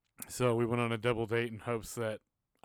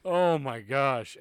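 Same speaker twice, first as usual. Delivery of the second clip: much louder, slow, drawn-out amazement; overwhelmed; 3 semitones higher, 12 dB louder, much slower than usual. Retold or imitated. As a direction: let it hit you all at once, slowly and loudly. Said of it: "you're just like".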